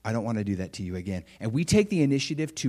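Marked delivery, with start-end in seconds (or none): 0.0-1.2 much softer, lowered, humble sorry; bow your voice down and plead gently; humble apology. Retold or imitated. Parent apologizing to wife or child, humble and soft. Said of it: "we humble ourselves and we say".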